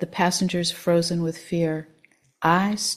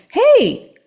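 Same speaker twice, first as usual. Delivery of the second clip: high, ear-splitting lilting "Hey!"; joyous shout; 10 dB louder than usual. A narrator's reading. Old man interrupting a fight on a train, joyous shout. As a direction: shout it as if delightedly stumbling on something. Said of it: "someone shouted".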